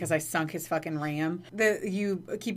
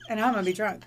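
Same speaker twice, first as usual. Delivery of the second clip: ordinary talk, nothing marked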